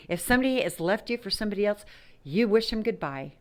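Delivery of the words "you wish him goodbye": normal talking voice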